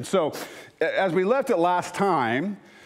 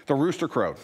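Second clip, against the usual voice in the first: ordinary talk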